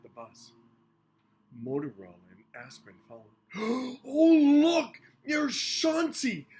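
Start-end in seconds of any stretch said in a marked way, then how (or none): 3.5-4.0 gasp. A narrator's reading. Aspirin sees dog on ad. 4.0-6.5 high, loud thrilled cry; excited exclaim. A narrator's reading. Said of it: "Aspirin exclaimed".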